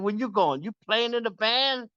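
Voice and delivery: high, teasing come-on voice; calling out sweetly to a passerby; sultry call. Retold or imitated. Hookers calling to passing musician.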